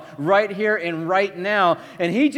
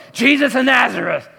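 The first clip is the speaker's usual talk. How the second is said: loud gruff soldiers shouting their target's name; 5 semitones higher, 5 dB louder than usual. Pretend. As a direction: bark the name back like a soldier. Soldiers answer, other voice.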